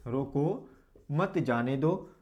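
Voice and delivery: रोको lifted, then मत जाने दो as one group; pause after रोको. Shown as a demonstration. Teacher repeating the comma example, pausing after रोको. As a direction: break after रोको, keep मत जाने दो joined. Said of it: "रोको के बाद में हमने क्या लगा दिया".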